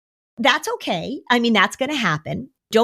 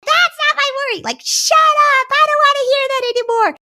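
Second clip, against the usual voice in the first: put-on much higher, louder whiny kid voice; imitating angry kid; 4 dB louder than usual. Kid snapping at parents who externalize worry, in a high whiny voice. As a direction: whine it out like a fed-up child. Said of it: "And then their kid says".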